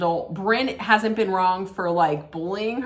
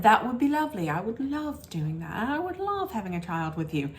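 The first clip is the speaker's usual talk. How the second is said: a hint of a softened, sugary husband voice, much softer; lightly voiced sweet reply, dropping softer; mock sweet husband; 2 semitones higher, 5 dB quieter than usual. Vlogger mimicking the husband promising a child, soft mocking sweetness. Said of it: "we saw Gideon was like".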